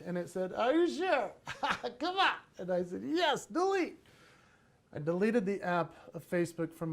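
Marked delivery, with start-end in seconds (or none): 0.5-2.3 high, playful coaxing voice; silly high-pitched pleading with a giggle; pleading phone app. Pretend. 3.0-4.0 high, emphatic reply to the app; playfully decisive; mock-firm command. Firm answer to pleading app.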